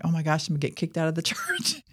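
quick whispered aside, half-laughing; panicked whisper. Retold or imitated. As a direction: whisper it like a scared secret. Fearing being kicked out.

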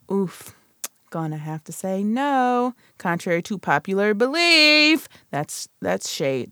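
ordinary delivery

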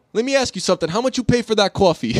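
high-pitched commercial impression; pushy, high-voiced question, played for laughs; Michael Rappaport. Shown as a demonstration. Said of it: "I've done it on T V".

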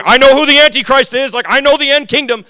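hammed-up overexcited believer; mock know-it-all. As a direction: lampoon a frantic end-times prophet. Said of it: "running around going".

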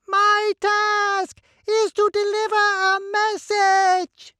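high, whiny little servant calling; a reedy, pleading little voice calling out. A narrator's reading. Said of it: "I can just hear his whiny voice".

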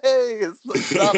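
delighted greeting. Retold or imitated. Co-host re-enacts spotting the actor on screen, delighted greeting. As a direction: greet an old pal, laughing and stretching it out. Acting out greeting an old friend.

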